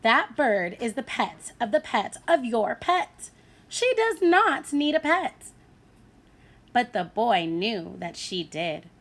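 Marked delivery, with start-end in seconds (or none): 0.0-5.5 mother's patience wearing thin; exasperated mother. A narrator's reading. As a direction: build her exasperation. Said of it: "said the boy's mother".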